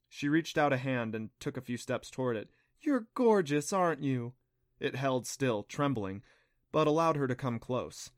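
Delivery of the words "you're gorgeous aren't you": put-on higher cooing baby talk; baby-talk a small animal. A narrator's reading. Clancy to the creature, higher cooing baby talk. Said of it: "She's baby-talking something".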